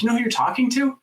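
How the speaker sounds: high, quick scoffing protest; incredulous retort. Retold or imitated. Room's incredulous reply to briefer.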